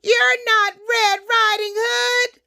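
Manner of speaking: high, wailing granny voice; alarmed grandmother. Acted. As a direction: cry it out high as a startled granny. Grandmother sees the wolf.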